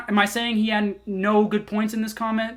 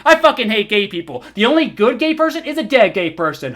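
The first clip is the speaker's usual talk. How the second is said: loud, higher sarcastic rant as the commenter imagines him; mocking caricature; 3 semitones higher, 5 dB louder than usual. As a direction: shout it like a cartoon bigot, mocking the misreading. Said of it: "This also applies to".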